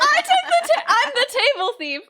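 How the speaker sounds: giggling, high and bright; laughing. Real, not acted. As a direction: laugh through the words. Laughing at taking the table.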